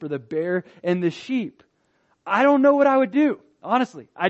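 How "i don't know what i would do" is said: loud, fast, high exclamation of doubt; exclaimed aloud. Real, not acted. Preacher to congregation, loud rapid admission of doubt. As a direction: blurt it out, raised and quick. Preacher imagining facing a lion.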